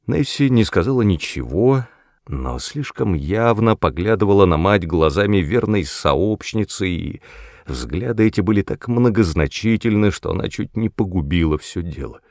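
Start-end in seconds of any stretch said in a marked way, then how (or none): none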